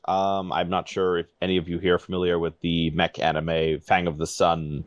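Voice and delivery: usual speaking voice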